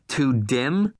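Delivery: indignant repeat, voice rising. A narrator's reading. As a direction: throw her words back at her. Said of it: "Irv's voice rose".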